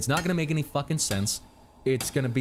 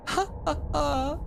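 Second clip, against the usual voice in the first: slow, high hammed-up cackle; high character laugh; 13 semitones higher than usual. Miner character laughing at the player, high hammed-up cackle. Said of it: "made a British voice for all of the characters".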